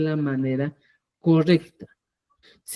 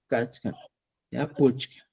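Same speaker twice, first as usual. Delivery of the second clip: ordinary talk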